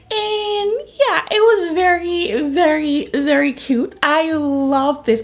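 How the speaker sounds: high, loud, slow gush of delight. Real, not acted. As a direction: stretch out how cute it was. Gushing over cute book.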